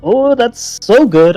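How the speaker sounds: ordinary talk, nothing marked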